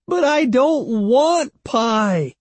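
put-on very high, loud child's wail; drawn-out, whining protest. A narrator's reading. Boy refusing his mother's comfort, wailing. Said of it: "Evan wailed".

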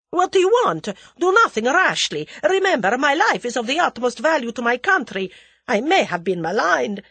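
high, loud, frightened pleading; cowardly Russian. Acted. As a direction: plead for your life as a Russian dignitary.

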